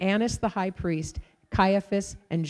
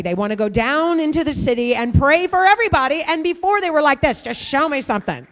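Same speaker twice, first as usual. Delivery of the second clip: rising to a loud, higher climax; building preaching; 6 semitones higher, 6 dB louder than usual. Acted. Preacher stirring the congregation, building to a loud climax. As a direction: drive it home harder and harder.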